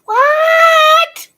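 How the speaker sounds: loud high shocked what; stunned scream of what; shocked shout. Real, not acted. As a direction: yell it in disbelief. Reactor hears saline news.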